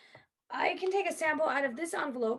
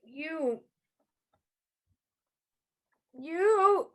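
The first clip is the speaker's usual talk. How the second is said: choking gasp; 4 semitones higher, 6 dB louder than usual. Acted. Poisoned, choking out words.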